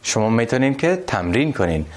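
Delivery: normal talking voice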